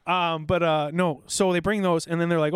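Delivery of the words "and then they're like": ordinary talk, nothing marked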